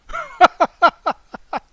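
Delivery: a sudden loud laugh up high. Real, not acted. Streamer joking to chat about his own death, loud laugh. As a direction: laugh hard at your own joke. Laughs after "I wouldn't haunt you.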